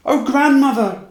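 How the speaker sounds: high ringing cry of longing; cried out. A narrator's reading. Little match girl crying out to her grandmother, high and loud. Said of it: "cried the child".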